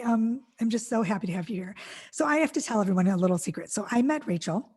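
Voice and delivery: usual speaking voice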